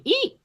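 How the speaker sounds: very high squeaky eat; printer squeal. Retold or imitated. Cook mimicking the order printer, a tiny high squeal. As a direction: squeal it like a machine beeping.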